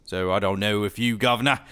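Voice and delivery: loud cod-British guv'nor; mock British accent. Shown as a demonstration. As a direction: put on a jokey British accent. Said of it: "our British accents are better than".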